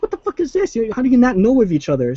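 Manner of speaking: high incredulous outburst; mock baffled disbelief; bewildered. Flash meeting Supergirl, hammed-up disbelief.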